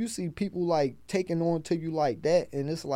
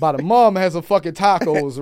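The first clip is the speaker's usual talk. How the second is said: mock shout; 2 semitones higher, 10 dB louder than usual. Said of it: "I said".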